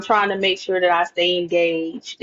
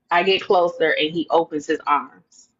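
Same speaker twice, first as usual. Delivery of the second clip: ordinary delivery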